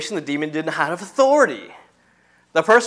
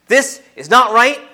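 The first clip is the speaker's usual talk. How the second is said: louder, higher, forceful rebuke; 7 semitones higher, 6 dB louder than usual. Acted. Jesus rebukes the evil spirit, forceful rebuke. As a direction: rebuke firmly with raised voice. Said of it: "He rebukes the evil spirit".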